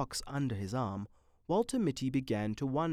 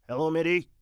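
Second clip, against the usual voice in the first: Renshaw; 4 semitones higher, 6 dB louder, faster than usual. A narrator's reading. Renshaw greets Mitty.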